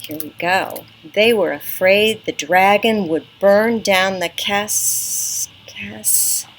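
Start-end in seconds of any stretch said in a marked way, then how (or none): 1.1-6.4 slow, stuck on the word cas-; halting reading; slow sounding out. Shown as a demonstration. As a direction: pretend to struggle sounding it out. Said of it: "Can you help me with that?".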